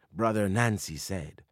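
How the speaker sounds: plain reading voice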